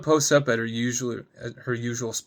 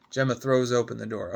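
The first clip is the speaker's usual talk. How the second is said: ordinary delivery, nothing marked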